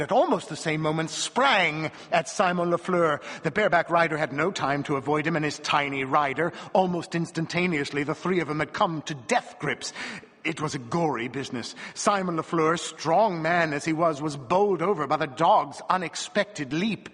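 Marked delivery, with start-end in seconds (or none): none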